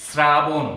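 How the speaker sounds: শ্রাবণ said as "srabon", ending in o; correct pronunciation. Shown as a demonstration. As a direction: pronounce শ্রাবণ as srabon with a rounded o at the end. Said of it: "শুদ্ধ উচ্চারণ হলো". (শ্রাবণ শব্দটি "স্রাবোন" উচ্চারণে, শেষে ও-কার)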